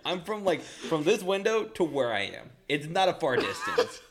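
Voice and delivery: high, loud giggling narration; laughing. Real, not acted. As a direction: keep laughing as you tell it. Laughing through the story.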